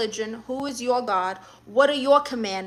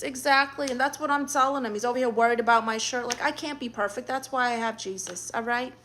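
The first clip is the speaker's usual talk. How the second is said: normal talking voice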